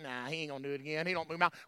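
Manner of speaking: very low, hushed aside; mock doubting mutter. Singer privately doubting the song, mock doubting mutter. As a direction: mutter it under your breath, dismissively.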